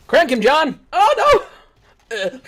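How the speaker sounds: high, loud hype yells; excited shouting. Real, not acted. Gamer yelling at teammate John to snipe. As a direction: cheer your teammate on.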